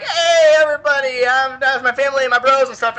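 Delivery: a show-off host calling his family in; Slaanesh hailing the other demons, loud and high; Slaanesh party host. Retold or imitated. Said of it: "He's like".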